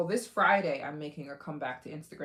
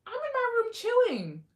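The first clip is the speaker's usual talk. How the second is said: very high-pitched mock protest; imitated reluctant kid; 5 dB louder than usual. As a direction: act out whining at mom in a high voice. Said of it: "I'm like".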